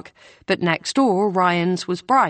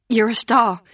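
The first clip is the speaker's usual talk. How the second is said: breathless; 4 semitones higher, 4 dB louder than usual. A narrator's reading. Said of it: "Hannah says breathlessly".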